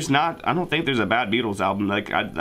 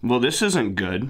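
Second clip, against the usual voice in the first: ordinary talk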